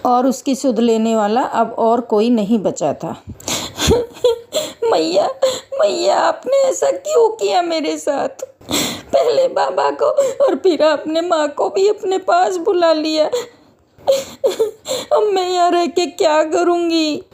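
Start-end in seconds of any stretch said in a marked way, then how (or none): 3.2-4.9 acted sobbing. Orphaned daughter weeps before the goddess, sobbing. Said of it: "फूट फूट कर रो रही थी". 4.9-17.2 put-on high, tearful child's plea broken by sobs; weeping bitterly. Orphaned daughter asks the goddess to take her too, weeping. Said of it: "Vaishnavi Mata Rani के सामने फूट फूट कर रो रही थी".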